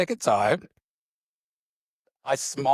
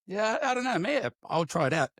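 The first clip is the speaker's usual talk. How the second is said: unsure reply; 9 semitones higher, 3 dB quieter than usual. Retold or imitated. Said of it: "And I was like".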